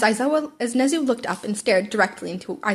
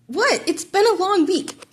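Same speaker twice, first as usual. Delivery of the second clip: high defensive whine; 8 semitones higher, slower than usual. A narrator's reading.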